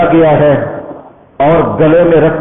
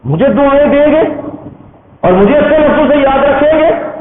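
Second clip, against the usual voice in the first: high, rising disbelieving father; acted astonished questioning; incredulous question; 8 semitones higher, faster than usual. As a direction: ask it high, as if it cannot be true. Dying father doubts son's promise.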